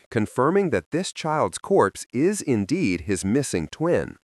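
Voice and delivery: plain reading voice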